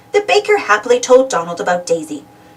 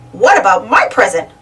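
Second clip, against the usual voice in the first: a huffy duck voice, a bit louder; 4 dB louder than usual. A narrator's reading. Donald grumbling to his friends about his present, huffy. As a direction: pout it out like a jealous duck. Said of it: "Donald huffed".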